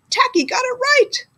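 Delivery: bright high triumph; delighted. A narrator's reading. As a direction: celebrate it up high. Triumphant moment.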